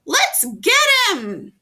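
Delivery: very high, loud gleeful screech; helper shriek. A narrator's reading. Helper urging the others at Norman, very high loud screech. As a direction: shriek it as you charge.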